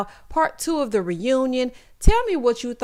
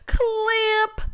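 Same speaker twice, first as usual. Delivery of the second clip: Dorinda impression; 11 semitones higher than usual. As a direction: belt out the one word, stretched high. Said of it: "Y'all know Dorinda".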